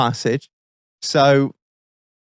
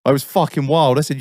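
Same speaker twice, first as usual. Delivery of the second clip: normal talking voice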